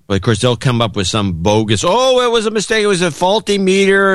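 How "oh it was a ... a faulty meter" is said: high, fake-innocent corporate excuse; mock company excuse. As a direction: play the company weaselling out of it. Mocking the company's bogus excuse.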